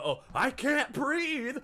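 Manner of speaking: high, slow, whiny plea; mock whine. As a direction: fake a pitiful complaint. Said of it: "you want to pull the".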